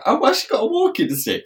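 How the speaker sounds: high, quick puzzled question; mock-baffled, hurried query; baffled surprise. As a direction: retell it in high-pitched bewilderment. Retold surprise at seeing stick.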